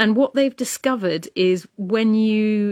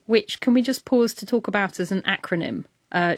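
usual speaking voice

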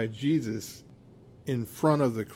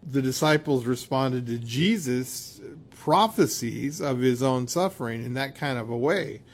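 ordinary talk

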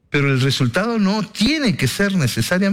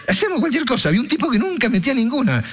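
excited; 9 semitones higher, faster than usual. Real, not acted. Excited basketball memory.

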